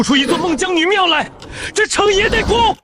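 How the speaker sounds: high furious insistence; angry shout. Acted. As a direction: bark it at the general. Insisting angrily to commander.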